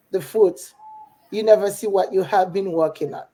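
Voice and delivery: normal talking voice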